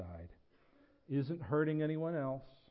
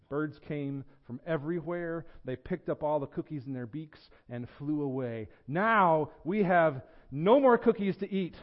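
animated read-aloud with lifted character lines; story reading; 3 semitones higher, 7 dB louder than usual. A narrator's reading. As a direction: tell it like a children's picture book. Reads a children's story to congregation.

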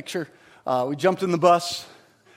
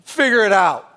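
dismissive dad; 5 semitones higher, 7 dB louder than usual. Retold or imitated. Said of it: "Dad didn't sit on the bus and go".

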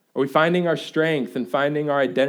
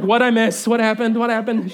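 raised, urgent repeated questions; eager questioning; 6 semitones higher, 4 dB louder than usual. Retold or imitated. Dad coming home to wife's baby news, excited. Acts out eager reaction to wife.

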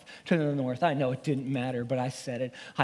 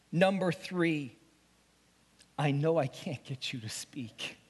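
usual speaking voice

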